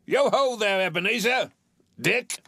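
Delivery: rich, oily, fat jovial voice, pitched high; jovial call. A narrator's reading. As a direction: call out heartily like a comfortable old master. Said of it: "called out in a comfortable, oily, rich, fat, jovial voice".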